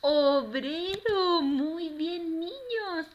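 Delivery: put-on high, drawn-out praise for the children; triumphant teacher praising a class; cheering the answer. Shown as a demonstration. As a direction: celebrate the right answer, loud at the start.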